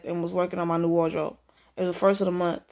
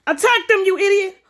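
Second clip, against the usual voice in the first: high, loud furious order; furious yell; 13 semitones higher, 8 dB louder than usual. Acted. Boss yelling at a bumbling henchman, high and loud. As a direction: scream the order at the fool.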